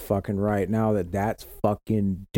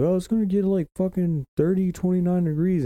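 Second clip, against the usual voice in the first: high mock-worried locals; 9 semitones higher than usual. Locals warning about the cold, mocked in a high voice. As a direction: pitch it up like fretting neighbors. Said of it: "they're like".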